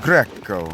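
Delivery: Greco with both C's hit hard; Greco, loud, with both C's stressed; Greek-style Greco. Shown as a demonstration. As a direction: bite each C in Greco. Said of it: "like they hit both C's".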